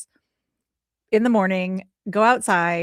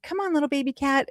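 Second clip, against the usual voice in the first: coaxing; 7 semitones higher, 3 dB quieter than usual. Retold or imitated. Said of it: "And then I said".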